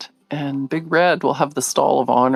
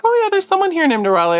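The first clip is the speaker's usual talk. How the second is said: daughter's voice; 12 semitones higher, faster than usual. Retold or imitated. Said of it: "And she's like".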